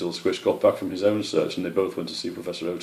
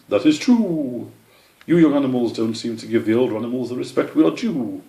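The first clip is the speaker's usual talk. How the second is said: put-on stern old owl; 5 semitones higher, 5 dB louder than usual. Owl lectures.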